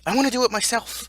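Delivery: high, loud insistent cry; petulant outburst. Acted. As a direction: burst out loudly like a stubborn kid. Mock-petulant outburst in a rant.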